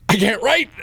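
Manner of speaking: high whiny plea; whiny pleading. Retold or imitated. Patient pleading with the ER receptionist, high and whiny. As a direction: plead like a hurt kid at the counter.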